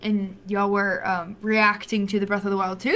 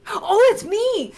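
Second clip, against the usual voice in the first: high, slow dawning oh; retelling the moment it clicked, high and slow; sudden realization; 11 semitones higher, 5 dB louder, slower than usual. Retold or imitated. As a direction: realize it's you, pitched way up. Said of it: "I'm like".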